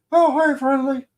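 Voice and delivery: squeaky-high, loud cartoon greeting; high puppet voice. Greeting Friendly as a show puppet would, high puppet voice. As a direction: pipe up like a children's-show puppet.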